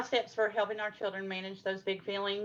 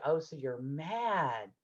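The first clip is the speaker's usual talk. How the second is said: acknowledging; 2 semitones lower, 7 dB quieter, slower than usual. Shown as a demonstration. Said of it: "So then you acknowledge".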